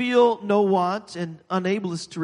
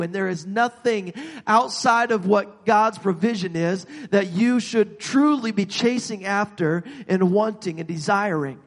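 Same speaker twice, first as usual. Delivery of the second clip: ordinary talk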